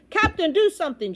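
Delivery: high, loud urgent plea; shouted. A narrator's reading. Boy begs the captain to act, shouting. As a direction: shout for action. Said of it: "shouted Bartholomew".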